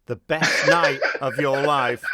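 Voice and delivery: laughing through the words. Real, not acted. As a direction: say it while laughing slowly. Repeating the line laughing.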